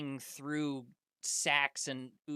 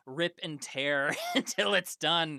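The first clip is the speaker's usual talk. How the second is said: laughing; 5 semitones higher, 10 dB louder than usual. Acted. GM narrating gory kill, laughing.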